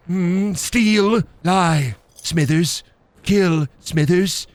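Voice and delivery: creaky scheming tycoon; drawn-out sinister one-word orders; Mister Burns. Acted. Burns urging Smithers to crime, scheming tycoon voice. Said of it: "I can do Mister Burns".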